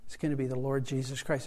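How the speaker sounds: ordinary talk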